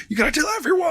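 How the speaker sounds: very high falsetto mock call; squeaky background heckler impression; Iron Fist. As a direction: pretend distant urging in a tiny voice. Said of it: "Iron Fist in the background".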